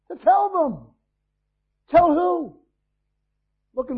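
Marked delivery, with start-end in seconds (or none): 0.1-2.6 high, loud preaching cry. Real, not acted. Preacher crying out to the congregation, loud and high.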